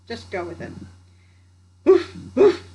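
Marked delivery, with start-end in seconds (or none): 1.8-2.6 loud, high woof woof; playful loud dog bark. A narrator's reading. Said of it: "the dog barked".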